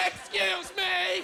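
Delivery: slow, high mock outraged Excuse me; 1 indignant lady. Bumped lady snapping at him, high and indignant. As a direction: stretch out Excuse me like an offended lady. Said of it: "she freaks out".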